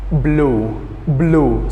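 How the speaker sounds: "Blue" said slowly with a clear b release; model word. Shown as a demonstration. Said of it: "We have the word what?".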